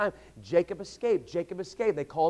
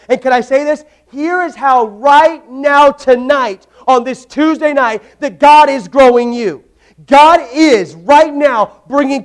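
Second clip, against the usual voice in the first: full-force preaching; 6 semitones higher, 10 dB louder than usual. Real, not acted. Preacher drives the point home to the congregation, loud preaching.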